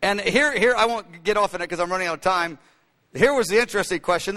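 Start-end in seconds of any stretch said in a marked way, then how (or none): none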